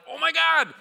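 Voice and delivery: high, loud mock panic; retold freak-out. Youth leader spots gators near the students, mock panic. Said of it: "I start freaking out".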